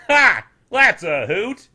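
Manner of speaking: high, loud guffawing jeer; mocking laugh. A narrator's reading. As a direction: laugh out at his tumble. Farmer laughs at fall.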